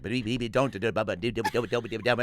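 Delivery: babbled cartoon stammer; stuttering Porky Pig. Cartoon pig trying to warn the dog, helpless stutter. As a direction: trip over every syllable without landing the word. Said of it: "he couldn't get the words out".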